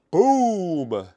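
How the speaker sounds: loud, high "boom"; excited. Real, not acted. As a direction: punch the reveal like a hit. Reveals a numbered card.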